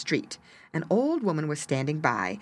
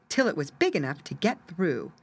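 plain reading voice

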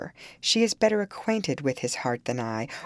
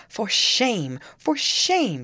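higher on the repeat, mildly indignant; angry repetition; 7 semitones higher, 4 dB louder, much slower than usual. A narrator's reading. Young woman rebuking her sister-in-law, mildly angry. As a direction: scold with rising indignation. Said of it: "she repeated angrily".

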